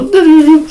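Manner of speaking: high beeping noise mimicking a device; imitated beeping. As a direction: chirp it like a dying charger. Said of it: "I just heard it go".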